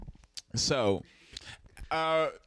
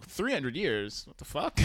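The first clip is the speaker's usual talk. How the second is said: low, incredulous echo; retold baffled reaction, kept under the breath; mild disbelief; 6 semitones lower, 4 dB quieter than usual. Crew reacting to the prisoner's sentence, quietly incredulous.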